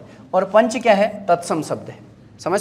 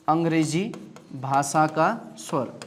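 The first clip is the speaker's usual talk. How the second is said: very slow, each word drawn out so the class can write it; slow dictation; 5 semitones lower, 7 dB quieter, much slower than usual. Shown as a demonstration. Teacher slowly dictates the term to the class, drawn-out. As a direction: drag out अंग्रेजी भाषा का स्वर like dictating to students.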